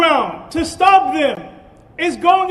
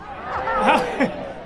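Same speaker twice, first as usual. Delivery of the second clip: short laugh, quieter and higher; 4 semitones higher, 7 dB quieter than usual. Real, not acted. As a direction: laugh at your own joke. Laughs at own joke.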